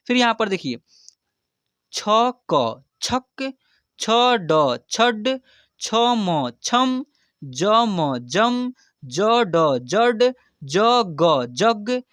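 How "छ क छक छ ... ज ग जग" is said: very slow, letter by letter, then the word; slow spelling out. Shown as a demonstration. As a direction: spell each sound apart, then join. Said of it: "अब एक बार इसको फटाफट से जल्दी पढ़ते कैसे हैं". (बहुत धीरे अक्षर अलग करके)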